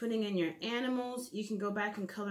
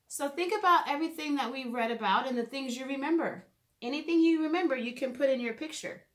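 bright teacher recap; 4 semitones higher, 4 dB louder than usual. Acted. Upbeat recap of finished drawing.

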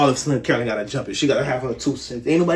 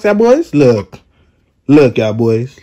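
calling out; 2 semitones lower, 8 dB louder, much slower than usual. Real, not acted. Calling the boys over to look, loud. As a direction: call them over loud.

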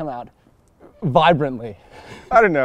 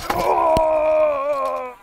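long, high-pitched "ohh" holler; 12 semitones higher than usual. Real, not acted. Rider reacting to a trick, high drawn-out holler. Cries out while riding.

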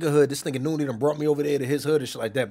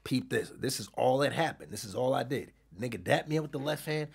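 usual speaking voice

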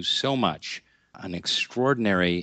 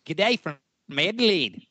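hammed-up Australian greeting, pitched higher; playful Australian "G'day"; a cheeky mock Aussie hello; 9 semitones higher than usual. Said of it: "G'day, mate".

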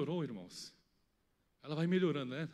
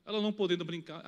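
ordinary talk

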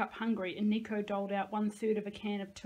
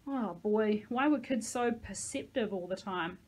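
plain reading voice

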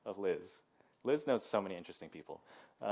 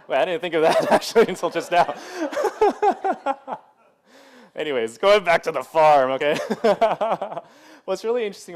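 laughing; 8 semitones higher, 14 dB louder than usual. Real, not acted. Laughing at own realization.

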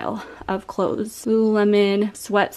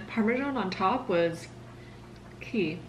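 whispering; 10 dB quieter than usual. Real, not acted. Tasting the bread, aside.

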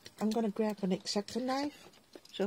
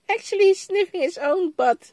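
high, fast giggle through the words; real laughter at the dog; laughing; 10 semitones higher, 8 dB louder than usual. Real, not acted. A crafter laughs to viewers about her dog, giggling.

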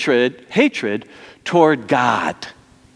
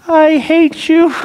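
high, loud, drawn-out tantrum voice; child's temper tantrum; 14 semitones higher, 6 dB louder, slower than usual. Acted. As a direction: mimic a small child throwing a tantrum at a parent. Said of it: "a little child with a temper tantrum".

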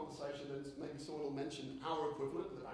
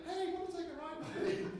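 put-on much higher, louder chirpy kids' ride voice; squeaky cartoon pig voice; 13 semitones higher, 5 dB louder than usual. Speaker imitates Peppa Pig ride greeting him, high and chirpy. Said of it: "a voice saying".

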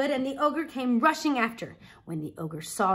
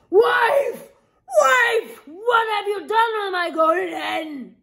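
furious high shouting, much louder; roaring ogre; 10 semitones higher, 7 dB louder, slower than usual. Acted. Ogre roars at his wife over the stolen hen, furious. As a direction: bellow for the stolen hen in a rage. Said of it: "he heard him roaring".

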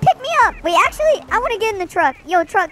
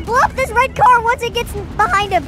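ordinary delivery

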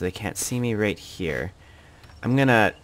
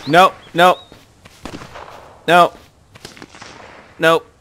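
loud high repeated nope; panicked nope; 9 semitones higher, 11 dB louder, slower than usual. Real, not acted. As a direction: yelp it out in alarm. Reacting to danger.

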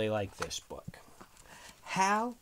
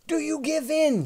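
very high, loud challenge; shouted demand; 9 dB louder than usual. A narrator's reading. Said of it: "shouted Hal".

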